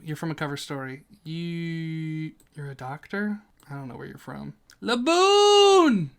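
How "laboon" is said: high, loud, drawn-out cheer; excited shout. Real, not acted. Ranker greeting a favourite whale, excited shout. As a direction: belt the name out high and long. Calling out a favourite character's name.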